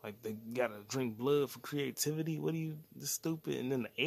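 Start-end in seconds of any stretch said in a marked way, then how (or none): none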